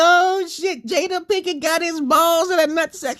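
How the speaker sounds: high, hammed-up shocked jeer; retold exclamation. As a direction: crow it out with disbelief. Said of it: "I said, like".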